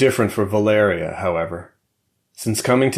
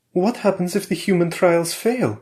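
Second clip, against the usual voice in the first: higher, worried wife's voice; a wary wife pressing her husband; Valeria's voice; 10 semitones higher than usual. A narrator's reading. Wife questions her husband about the trials, higher and worried.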